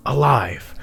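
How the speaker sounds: ordinary delivery, nothing marked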